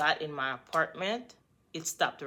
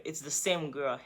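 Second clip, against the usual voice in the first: ordinary talk, nothing marked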